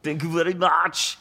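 slow, high-pitched mock-Austrian accent; drawn-out Austrian impression; Arnold Schwarzenegger. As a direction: sign off as an Austrian action hero. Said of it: "you can probably do the accent".

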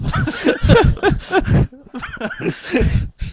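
long high laughter; can't stop laughing; real laugh while telling the story. Real, not acted.

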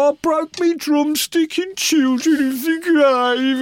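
hammed-up Bill, high and slow; ranting drummer. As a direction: drag out the demand, high and loud. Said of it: "And he go".

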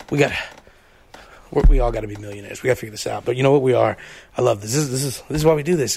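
low, lightly voiced dreamy Mike; a hint of Mike gushing to the crew; Mike impression. Co-host imitates Mike at Oasis, low and dreamy. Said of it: "my Oasis impression of Mike".

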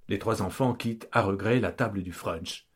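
usual speaking voice

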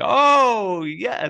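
loud, high mock yell. Podcaster imitating yelling in a hotel room at five, mock shout. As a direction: belt it out like excited shouting. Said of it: "shouting and yelling and saying".

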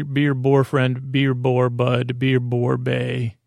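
ordinary talk, nothing marked

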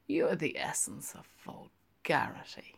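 unvoiced, gliding reproach; whispered teasing scold; soft whisper. A narrator's reading. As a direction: whisper it with mock disdain. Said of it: "in gliding, said".

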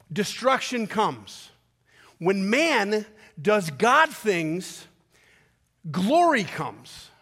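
drawn out, each phrase weighted; slow emphatic preaching. Acted. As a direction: lay it down slowly like a verdict. Preacher slows for key contrast.

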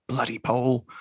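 RAF officer's gripe, barely acted; lightly voiced complaint about a stolen kill; retold grudging remark. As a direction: grumble it at ordinary pitch. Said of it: "that moment where he's sort of, like, flipped".

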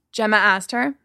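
ordinary delivery

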